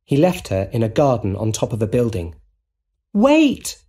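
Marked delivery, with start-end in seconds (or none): 3.1-3.8 high, urgent call. A narrator's reading. MJ calls after her departing rescuer, calling out. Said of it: "she called".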